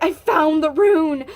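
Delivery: loud, slow triumphant cry; slow, loud joyful shout; reenacted screaming joy. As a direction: announce the find, overjoyed. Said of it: "I was, like, screaming joy".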